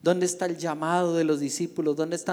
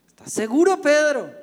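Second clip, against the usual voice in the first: much higher, loud challenging cry; challenging question; 11 semitones higher, 13 dB louder than usual. Acted. Jesus challenges Peter's commitment, high and loud. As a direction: call him out to test his resolve.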